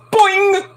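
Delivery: high springy boing sound; playful high boing; cartoon boing. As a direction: make the sound of the lids clanging like a cartoon. Cartoon sound effect for lids.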